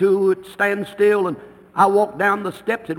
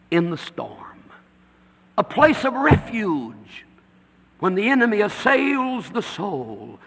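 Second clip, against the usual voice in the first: normal talking voice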